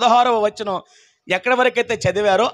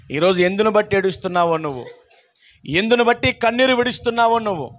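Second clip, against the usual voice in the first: ordinary talk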